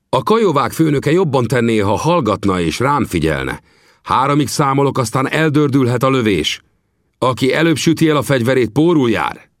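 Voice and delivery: Vinetú sternly calling the Kiowa chief to order; firm, commanding chieftain; stern rebuke. Acted. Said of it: "megelégelte és rászólt".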